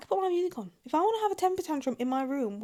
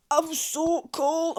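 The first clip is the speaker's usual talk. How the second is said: higher, slow "I'm so cold" with "so" stretched out; drawn-out shiver; 5 semitones higher, much slower than usual. Real, not acted. Shivering vlogger complaining about the cold, slow moaning.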